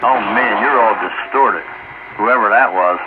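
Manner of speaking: normal talking voice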